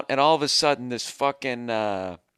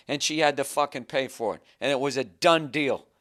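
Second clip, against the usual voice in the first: ordinary delivery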